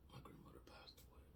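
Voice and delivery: choked whisper through tears; barely voiced, crying; tearful whisper. Real, not acted. Tearful whisper about grandmother.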